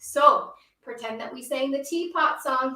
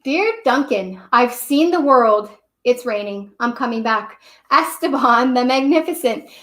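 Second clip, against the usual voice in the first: a lightly grand Esteban, read louder; grand crayon letter; 8 dB louder than usual. Acted. Storyteller reads Esteban's grand postcard to Duncan, louder. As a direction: announce it like a pompous traveller.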